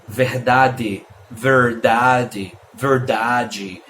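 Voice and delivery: verdade three ways, the R and the final DE changing; regional variants. Shown as a demonstration. Teacher showing regional variety, verdade in three low-pitched versions. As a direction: say verdade with a plain DE, a rolled R, then DJEE. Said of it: "várias formas de se falar".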